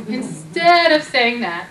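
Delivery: normal talking voice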